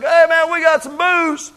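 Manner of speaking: high, loud party call; mock biker call. Bikers calling a preacher over, mock party voice. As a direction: play the guys waving the jug.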